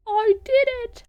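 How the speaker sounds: high, bright cry of victory; triumphant cheer. Real, not acted. Gamer cheering after solving a puzzle, triumphant. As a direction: shout it like you finally cracked it.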